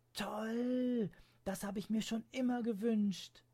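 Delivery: high, loud cry of joy; a child thrilled by her gift; high, loud, overjoyed child; delighted girl. Acted. Girl unwraps wished-for gift.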